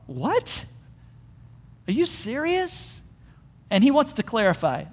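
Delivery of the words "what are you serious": soft, rising disbelief; slow, high, hushed double-check; incredulous. Acted. Acts David's disbelief at reward.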